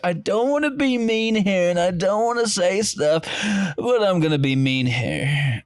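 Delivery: high, put-on catty voice; fake-polite, then mean; inner Jessica. Mocking a friend who says mean things politely. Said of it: "your inner Jessica coming out again".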